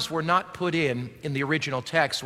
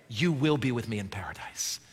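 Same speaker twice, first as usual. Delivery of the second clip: whispered promise, much softer; hushed Jesus; 7 semitones lower, 6 dB quieter than usual. Acted. Pastor voicing Jesus whispering to the thief. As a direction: drop to a whisper for the final promise. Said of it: "Jesus is saying to that thief".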